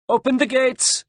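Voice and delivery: very high, carrying call; shouted order. A narrator's reading. Sergeant relays captain's order to the gate, shouted. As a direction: call the command out to the gatekeepers. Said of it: "shouted the sergeant".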